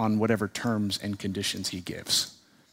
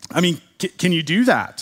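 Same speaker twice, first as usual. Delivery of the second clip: higher, stammering disbelief; acted flabbergasted; 5 semitones higher, 5 dB louder than usual. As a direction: play the stunned shop owner, tripping on words. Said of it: "The shop owner is just flabbergasted".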